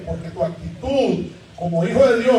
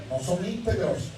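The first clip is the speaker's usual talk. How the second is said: quiet; 4 semitones lower, 8 dB quieter than usual. Shown as a demonstration. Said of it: "quiet repeat of same words".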